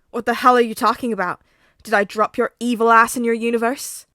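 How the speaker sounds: loud, fast angry shout; furious yelling. Acted. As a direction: scream it in his face. Katsuki shouting.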